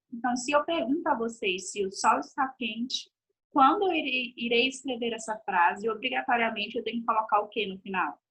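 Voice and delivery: normal talking voice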